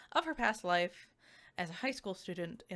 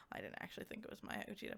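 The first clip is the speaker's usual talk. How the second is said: fast whispered aside; hushed quick aside; 13 dB quieter, much faster than usual. Real, not acted. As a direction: rush it out under your breath.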